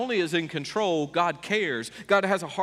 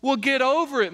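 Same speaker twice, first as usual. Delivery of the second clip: loud, high brush-off; retort; 8 semitones higher, 4 dB louder than usual. Acted. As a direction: snap back at the objector.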